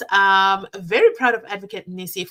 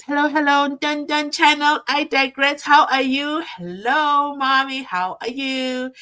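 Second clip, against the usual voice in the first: cheery greeting; 7 semitones higher, 6 dB louder than usual. Retold or imitated. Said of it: "Sandra says".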